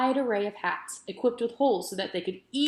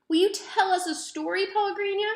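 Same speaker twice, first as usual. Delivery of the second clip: put-on much higher, a young girl begging sweetly; pleading little girl; 11 semitones higher than usual. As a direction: ask like a hopeful child at bedtime. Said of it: "Abilene asked her".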